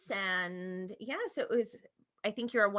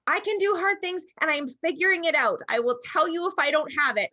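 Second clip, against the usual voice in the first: imitating her daughter's teary, louder, higher voice; teary determination; 8 semitones higher, 6 dB louder than usual. Mom retells her daughter working through frustration, teary and determined. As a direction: voice up high and wet, pushing through tears. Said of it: "I could still hear the tears".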